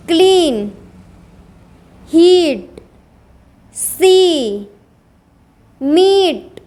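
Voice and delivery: "क्लीन, हीट, सी, मीट" with a long ee. Shown as a demonstration. Teacher drilling ea words as ee, "क्लीन, हीट, सी, मीट" stretched. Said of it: "ई, ए मतलब ई".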